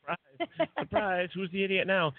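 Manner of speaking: sing-song told-you-so, tossed at the doubters; mock gloating. Host teasing people who doubt them, playful mock gloating.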